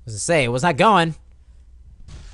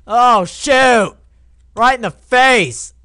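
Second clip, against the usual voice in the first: high, loud outburst; yelling; 6 semitones higher, 6 dB louder, slower than usual. Real, not acted. Player hit in the game, loud yell. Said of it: "terrible on my part to yell".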